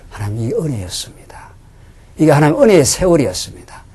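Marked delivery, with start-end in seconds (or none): 0.1-1.3 unvoiced, hushed confession of grace; preacher testifying to his congregation, whispered; whispered testimony. Real, not acted. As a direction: drop to a reverent whisper.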